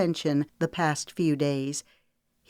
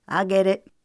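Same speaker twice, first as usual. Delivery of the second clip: mild yell; 3 semitones higher, 5 dB louder than usual. Acted. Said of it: "Harlan yelled".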